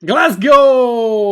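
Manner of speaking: loud exaggerated Scottish Glasgow; mock Scottish shout. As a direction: shout it in a hammy Scottish accent. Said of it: "stop saying it like that".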